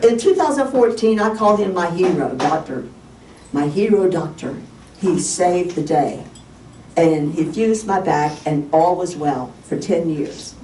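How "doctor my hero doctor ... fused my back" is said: ordinary delivery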